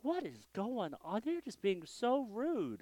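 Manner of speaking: high-pitched, incredulous complaint; indignant inner reaction. Retold or imitated. Pastor recalling his reaction to laughter during his sermon, indignant. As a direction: voice a baffled grumble up high. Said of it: "I'm like going".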